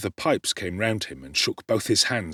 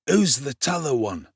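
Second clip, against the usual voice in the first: higher, suspicious rough question; suspicious doorman; 5 semitones higher than usual. A narrator's reading. Man at the stair asks Dodger, suspicious. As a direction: demand who the stranger is.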